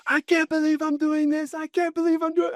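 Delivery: very high mock-panicked squeal; hammed-up high panic voice; imitated freaking out. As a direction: squeal it like a man losing his nerve midair. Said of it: "freaking out".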